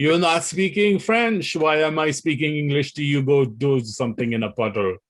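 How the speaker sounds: a rude Quebecer in a hammed-up Quebec French accent; mock Quebec French accent. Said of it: "that uppity Quebec accent".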